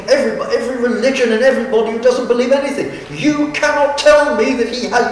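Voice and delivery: high, fast, indignant mock shouting; retold angry shout. Young girl heckling the preacher in Hyde Park, high indignant shout. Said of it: "she shouted back at him".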